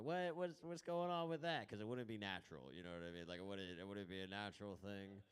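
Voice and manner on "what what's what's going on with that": much higher and louder, startled; mock surprise. Comic voicing people shocked by his afro, high startled. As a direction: play a shocked stranger. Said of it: "they'd be like".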